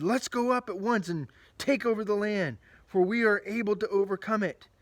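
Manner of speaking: rally the crowd to march now; rallying call. Acted. Caleb urges the people to take the land, loud and rousing.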